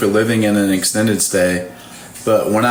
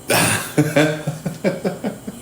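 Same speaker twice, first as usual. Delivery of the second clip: short laugh; 6 semitones higher, 5 dB quieter than usual. Real, not acted. Laughing at own story.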